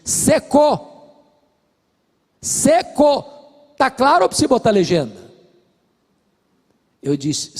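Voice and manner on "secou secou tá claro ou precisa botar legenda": high, loud and irritated; wife fed up with the pastor's questions, angry; angry snap. Retold or imitated. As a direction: throw the words at the pastor in anger. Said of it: "me fuzilou com um olhar nervoso e disse".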